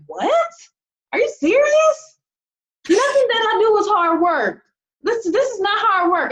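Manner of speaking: disbelief way up high, half crying out; incredulous high squeal. Retold or imitated. To lazy people online, a high squealing disbelief. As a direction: shriek it like you can't believe your ears. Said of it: "I'm like".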